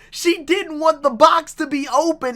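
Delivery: ordinary talk, nothing marked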